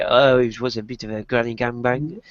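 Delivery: mock French accent, lightly done as Thierry Henry; a half-hearted French pundit voice; bad French accent, barely put on; terrible French accent. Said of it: "terrible French accent".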